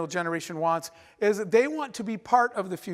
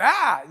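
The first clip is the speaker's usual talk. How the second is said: sharp startled "Ah"; startled gasp; 5 semitones higher, 6 dB louder than usual. Retold or imitated. Retelling the camel nose-to-nose moment, a startled gasp. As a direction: gasp as a camel's face meets yours. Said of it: "opened my mouth and went".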